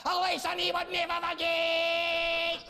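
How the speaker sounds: high, strained Scottish war cry; hammed-up Scottish bellow, long drawn-out words; mock Scottish rant. Comic to the Scots about Bannockburn, mock Scottish rant. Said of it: "you, Jocks, you like to say".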